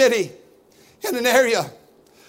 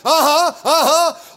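slow, higher drawn-out uh-huh; congregation response; 5 semitones higher, 5 dB louder, slower than usual. Retold or imitated. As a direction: answer the preacher from the pews.

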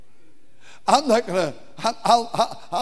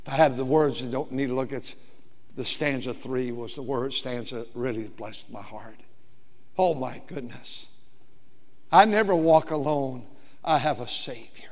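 much softer, lower, intimate; hushed testimony; 7 semitones lower, 7 dB quieter than usual. Acted. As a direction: lean in and almost whisper it. Preacher drops to hushed testimony.

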